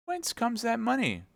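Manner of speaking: high startled question; surprised. Acted. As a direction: gasp it out in alarm. Wife startled by bag of money.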